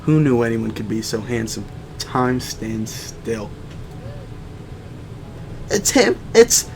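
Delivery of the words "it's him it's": put-on swooning girl voice. Swooning student voice.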